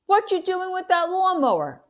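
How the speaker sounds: high, loud demanding shout; yelling. Acted. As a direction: call out after the boys, loud and sharp. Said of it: "I yell".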